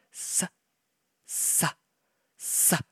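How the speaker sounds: whispered sa sa sa; breathy rasp of fingers on stone; soft hissing sa; soft scraping sound. A narrator's reading. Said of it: "went his fingers over the stone".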